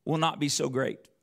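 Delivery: ordinary delivery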